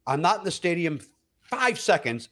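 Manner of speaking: ordinary delivery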